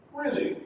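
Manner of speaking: "Really" pitched high, then falling; falling intonation. Shown as a demonstration. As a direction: start "Really" high and let it drop. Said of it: "Yeah, that's falling intonation".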